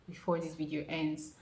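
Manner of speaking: ordinary talk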